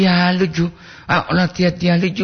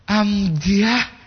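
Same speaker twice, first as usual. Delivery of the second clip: high-pitched mock disappointment; hammed-up disappointed delivery; full of disappointment; 6 semitones higher than usual. Shown as a demonstration. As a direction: act let down, pitch raised. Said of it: "Perasaan penuh kekecewaan itu".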